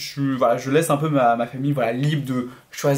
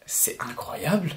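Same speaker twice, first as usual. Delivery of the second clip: slow, hushed whisper; whispered awe; 6 dB quieter, slower than usual. Real, not acted. Vlogger to viewers, whispering praise of the Mashle manga. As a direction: breathe it out like a secret treasure.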